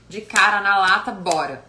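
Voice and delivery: loud, brash and hurried; pushy mock shout. Teacher mocking the no-fear mindset, brash loud urging. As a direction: bark it like someone who refuses to feel fear. Mocking fearless push-through attitude.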